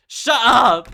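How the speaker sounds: put-on girl screaming at a classmate in anger; furious scream. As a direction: scream it with everything. Natsuki screaming in the club room.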